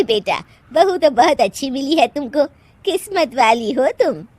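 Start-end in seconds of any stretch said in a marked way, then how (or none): none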